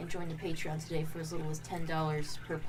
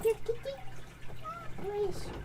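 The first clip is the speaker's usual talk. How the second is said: high coaxing call to a cat; pet talk; faster than usual. Real, not acted. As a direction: coax the kitty in a much higher voice. Calling the cat on stream.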